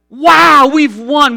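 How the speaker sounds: thrilled and loud; excited. Retold or imitated. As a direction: gasp out the win in disbelief. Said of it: "We're starting to scream".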